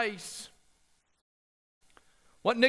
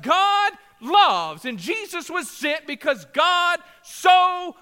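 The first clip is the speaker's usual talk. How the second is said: full-force preaching; 4 semitones higher, 5 dB louder than usual. Acted. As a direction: preach it over the congregation, driving every line home.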